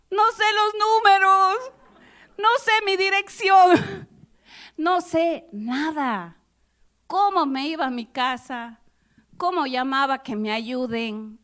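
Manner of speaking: high, loud, slow distressed pleading; frantic half-crying confession; panicked wail. Retold or imitated. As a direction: sob out that you know nothing. Panicked retold answer, no numbers.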